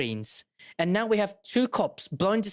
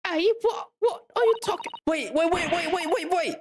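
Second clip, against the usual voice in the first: panicked wait; 10 semitones higher than usual. Real, not acted. Panic over the bomb.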